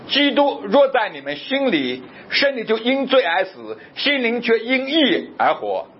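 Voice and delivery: a preacher reading scripture aloud from the pulpit. Acted. Reads Romans 8 aloud slowly.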